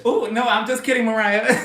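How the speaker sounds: laughing, high and loud; laughing apology. Real, not acted. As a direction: giggle through the apology. Teasing Mariah, then laughing.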